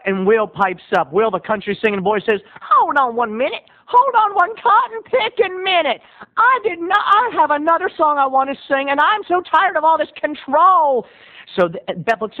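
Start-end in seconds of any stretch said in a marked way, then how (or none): 2.6-11.1 high, hammed-up outrage; indignant interruption. Country boy objects to rival's song, indignant. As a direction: break in with mock outrage.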